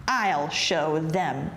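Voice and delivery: low, slow, determined vow; brooding anger. A narrator's reading. Big Anthony to himself after being laughed at, angry. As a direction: draw out each word, vowing revenge. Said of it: "Big Anthony was angry".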